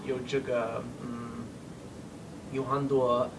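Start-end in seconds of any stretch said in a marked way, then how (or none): none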